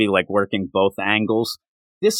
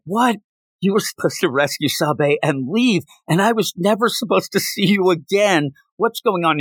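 higher, outraged disbelief; mock-furious Ochi, pitched up; losing his mind; 6 semitones higher than usual. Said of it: "Ochi loses his mind".

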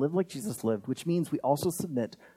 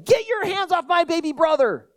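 high, loud protective big-sister yell; mock indignant yell; 12 semitones higher, 4 dB louder than usual. Sister to whoever took her baby brother, hammed-up yell. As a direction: yell it out as if scaring someone off. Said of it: "come out of the reeds yelling".

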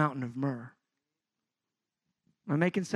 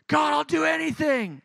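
much higher, louder outburst; excited vow; 11 semitones higher, 5 dB louder than usual. Retold or imitated. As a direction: blurt it out, overwhelmed. Worshipper carried away.

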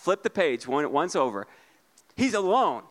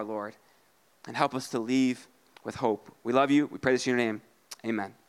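prayer; 5 semitones lower than usual. Acted. Pastor leads congregation in prayer.

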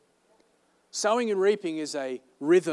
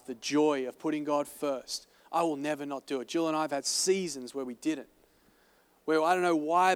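ordinary talk, nothing marked

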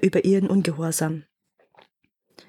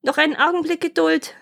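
high-pitched plea for delay; wife's higher voice, mildly pleading; 9 semitones higher than usual. A narrator's reading. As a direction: stall him in a high, pleading voice.

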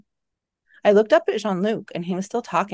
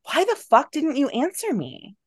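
high-pitched, exasperated question; upset demand; 9 semitones higher than usual. A narrator's reading. Scared woman to companion who ignored her, upset demand. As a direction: demand an answer, pitch raised in distress.

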